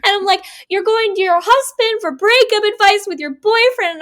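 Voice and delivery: high, loud incredulous rant; exasperated disbelief. Retold or imitated. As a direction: throw it back at her in disbelief. Host retells exasperated reaction to book.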